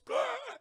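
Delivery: high sharp gasp; a sudden gasp for air; revival gasp. Acted. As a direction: gasp back to life. Elizabeth reviving.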